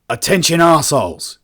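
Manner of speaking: loud, high, brash hail over the comms; brash shouted greeting. Acted. Adrian hails the colonists firing on his ship, shouting. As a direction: bark it out at the people shooting at you. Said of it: "he greeted".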